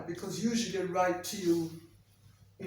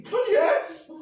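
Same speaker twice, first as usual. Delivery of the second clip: mock pained cry; 12 semitones higher, 7 dB louder than usual. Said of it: "and you think".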